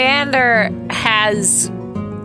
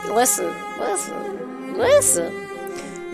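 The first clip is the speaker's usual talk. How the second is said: high playful aside; 12 semitones higher, 5 dB quieter, much slower than usual. Real, not acted. Playful aside after monster remark.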